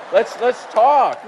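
loud, drawn-out eager challenge; booming mock eagerness; eager loud invitation. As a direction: jump at the chance, loudly, stretching the words. Eagerly takes up visitors' offer to talk.

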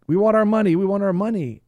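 high, loud shouted demand from the mob; angry crowd demand. Retold or imitated. Depositors at the bank counter demanding their cash, shouting. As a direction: yell it like panicked depositors at the counter.

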